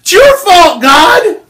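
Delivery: high, crying whine, then a shout; whiny Adam. Acted. Preacher acting Adam blaming God, crying then shouting. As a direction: sob out the excuse and then yell the blame. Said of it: "So he just said".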